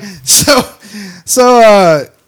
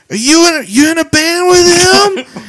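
mock drunk woman; 11 semitones higher, 4 dB louder than usual. Imitating the drunk woman quizzing them about a band. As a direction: pester like a drunk stranger. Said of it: "And she's like".

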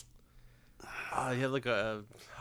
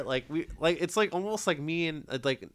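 normal talking voice

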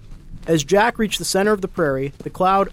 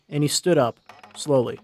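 ordinary delivery

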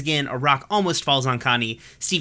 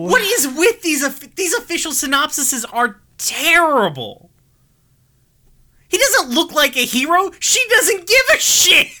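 much higher and louder, mock-furious; exasperated rant; 5 dB louder than usual. Real, not acted. Host fuming at terrible official synopses, shrill-high and loud. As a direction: vent like the synopsis personally offended you. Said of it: "I'm gonna be done being angry".

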